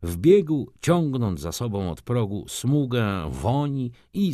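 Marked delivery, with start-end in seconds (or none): none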